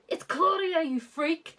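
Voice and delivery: high, hammed-up retort; mock snap. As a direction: snap back like an offended star. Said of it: "Josh snapped".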